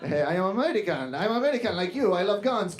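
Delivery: put-on insistent dad in thick Spanish-accented English; heavy Latin American accent. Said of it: "very heavy Latin American accent".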